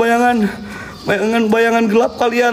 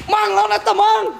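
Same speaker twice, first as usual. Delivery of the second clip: higher, urgent cry to the partner; panicked call; 6 semitones higher than usual. Real, not acted. Host to partner, scared of the ghost. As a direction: beg him to fight it, scared.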